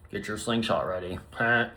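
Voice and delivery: ordinary delivery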